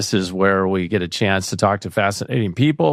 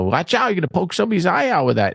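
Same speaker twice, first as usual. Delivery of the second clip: quick, higher-pitched imitation of mom; mom's warning; 7 semitones higher, faster than usual. Mom warning her child about poking eyes, retold scolding. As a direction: rattle off a mother's stock warning. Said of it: "my mom used to use that".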